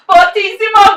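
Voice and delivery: fortissimo said loud, not sung; loud spoken fortissimo. Shown as a demonstration. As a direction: call out fortissimo. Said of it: "then we have fortissimo".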